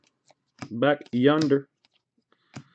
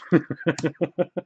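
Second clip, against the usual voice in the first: soft rapid giggle; laugh; 3 semitones higher, 4 dB quieter than usual. Real, not acted. Joking about being pissed off, giggling. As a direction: chuckle in quick little bursts.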